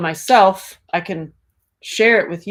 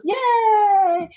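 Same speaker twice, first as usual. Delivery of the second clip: excited cheer; 4 dB louder than usual. Real, not acted. Cheering a viewer's comment.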